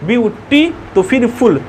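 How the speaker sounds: ब्यूटी in two beats, then फुल on its own; teacher breaking up ब्यूटी, then फुल; split into syllables. Shown as a demonstration. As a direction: sound out ब्यूटी and फुल as separate pieces. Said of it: "इसको एक ही बार में नहीं बोल पा रहे हैं".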